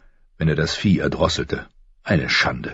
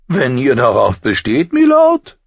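deutlich höher und lauter, spöttischer Zwerg; Tyrion, hohe Stimme; 8 semitones higher, 7 dB louder than usual. A narrator's reading. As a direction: hell und keck sprechen, wie ein Zwerg. Tyrion fügt sich. (Tyrion antwortet dem Lord Commander, hoch und keck)

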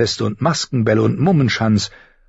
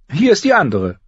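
high, louder call of announcement; announcing septa; 11 semitones higher, 6 dB louder than usual. Acted. Septa presents the other daughter to the father, announcing.

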